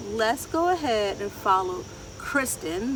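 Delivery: ordinary delivery, nothing marked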